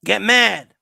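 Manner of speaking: ordinary talk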